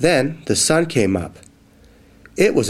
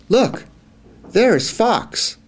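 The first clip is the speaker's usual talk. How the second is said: excited mouse; 9 semitones higher than usual. Acted. Mouse spots Fox.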